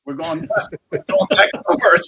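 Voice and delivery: high, breaking into laughter mid-line; imitating a friend cracking up as he speaks; laughing while talking. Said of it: "he's laughing so hard".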